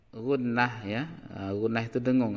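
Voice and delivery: ordinary delivery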